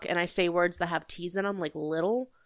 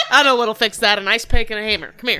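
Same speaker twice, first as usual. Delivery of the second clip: mock threat; 3 semitones higher, 10 dB louder than usual. Mock threat while laughing.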